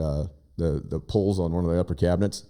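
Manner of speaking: ordinary talk, nothing marked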